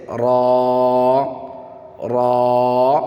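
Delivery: را with a thick, rounded long vowel; slow, full-mouthed را; full-mouthed heavy letter. Shown as a demonstration. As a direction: hold را heavy and steady, like "raw". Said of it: "صحیح سے pronunciation کرنا ہے".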